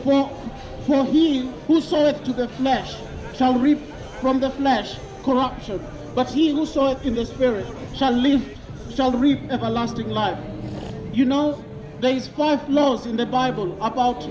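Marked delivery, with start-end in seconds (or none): none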